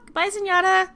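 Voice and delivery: high, loud goodbye; gushing farewell. Acted. Player voicing a goodbye to a friend, high and gushing. Voices a character's parting farewell.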